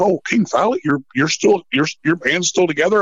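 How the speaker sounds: high-pitched mock surprise; mocking imitation. Imitating a rival's backhanded greeting.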